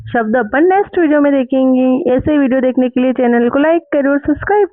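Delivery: cheerful video outro. Real, not acted. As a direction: wrap up the lesson like a YouTuber. Channel outro.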